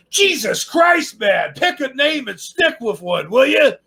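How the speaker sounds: high-pitched exasperated complaint; exasperated mock outburst. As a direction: blurt it out, fed up with it all. Mock outburst at the long aliases.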